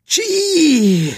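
long high-pitched sneeze; explosive sneeze. A narrator's reading. Baby elephant's mighty sneeze, squealed high. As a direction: burst into a drawn-out squealed sneeze. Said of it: "Die Wucht seines Niesers".

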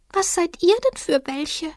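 a tiny shy creature peeking out; timid little creature. A narrator's reading. Little animal asking the strangers who they are, high and timid.

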